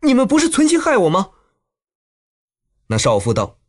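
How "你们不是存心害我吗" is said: high, quick puzzled question; astonished. A narrator's reading. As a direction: ask in baffled disbelief, pitched well up. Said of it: "肖钟会奇道".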